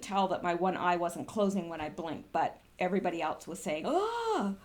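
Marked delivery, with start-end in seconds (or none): 3.9-4.6 surprised oh. Retold or imitated. Others reacting to her face.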